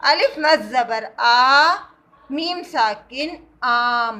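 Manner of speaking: آ and آم said slowly, stretched; careful spelling demo; long aa. Shown as a demonstration. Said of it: "بڑے آ کی آواز نکالنی ہے".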